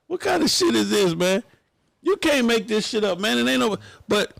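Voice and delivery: high, incredulous outcry; can't believe this, voice up high; outraged disbelief. Real, not acted.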